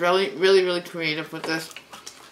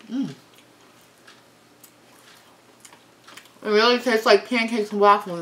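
words between bites; talking while chewing; slower than usual. Real, not acted. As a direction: mouth full of food. Seen 0: Chews waffle sandwich, bites at 2.3-3.6, cheeks full while speaking.

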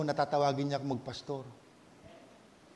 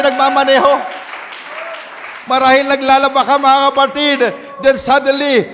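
building preacher's fervor, louder; preaching; 7 semitones higher, 14 dB louder than usual. Acted. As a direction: preach it with rising conviction. Full-force preaching.

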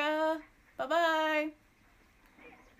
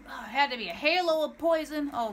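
ordinary talk

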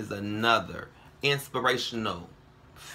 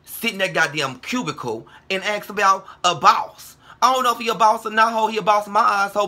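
heated rant; 6 semitones higher, 4 dB louder than usual. Real, not acted. Angry rant at critic.

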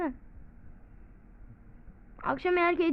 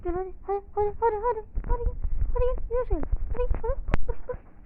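high, strained, almost crying come on; egging the enemy on, desperate; straining high hadi hadi; frantic urging; 8 semitones higher, much faster than usual. Real, not acted.

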